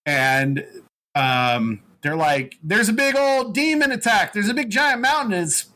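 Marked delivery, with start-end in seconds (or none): 2.6-5.7 lightly hammed-up Southern accent, pitched much higher; a Southern yokel's voice, higher up; put-on Southern voice. Host voicing the Banuk tribe in a mock Southern accent. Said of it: "they don't talk like they're Southern".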